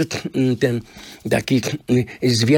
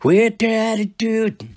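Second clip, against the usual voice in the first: high, slow, showy with attitude; 11 semitones higher, slower than usual. Retold or imitated. Drummer voices the band's showy TV performance, high and slow. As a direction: drawl it out with swagger. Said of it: "tym głosem".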